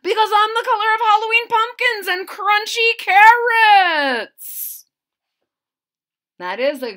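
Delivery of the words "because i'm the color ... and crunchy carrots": much higher, loud proud leaf; boastful leaf. Acted. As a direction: shout out your colour. Orange Maple voiced in story.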